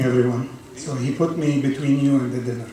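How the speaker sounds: ordinary delivery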